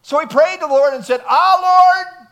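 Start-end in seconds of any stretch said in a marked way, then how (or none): 1.3-2.3 Jonah's complaint. Acted. Jonah praying in anger.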